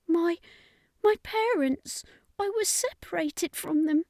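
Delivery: put-on lightly tearful child; lost crying child. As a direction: play a scared little boy calling for his parents. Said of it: "was crying".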